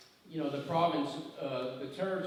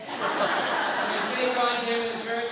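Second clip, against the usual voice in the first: slow, louder, laughing through a cheeky question; laughing joke; 7 semitones higher, 8 dB louder, slower than usual. Real, not acted. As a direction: tease the crowd while laughing. Joking to audience about swearing.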